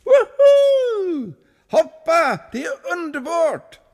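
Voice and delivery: very high, thrilled calling; joyful whoop. A narrator's reading. Kulan in the water calling up to the monk, thrilled. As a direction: shout up from the water, delighted. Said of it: "gav till ett indiantjut till och ropade".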